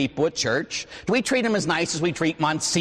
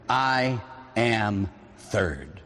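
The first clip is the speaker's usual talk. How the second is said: slow, deliberate motto; 5 semitones lower, 3 dB quieter, much slower than usual. Shown as a demonstration. The motto to write on the mirror.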